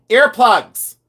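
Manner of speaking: loud, high one-word shout; retold order, blasted out; exasperated repeat. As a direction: call it out for someone who didn't hear. Repeating order to someone not hearing.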